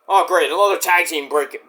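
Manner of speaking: ordinary delivery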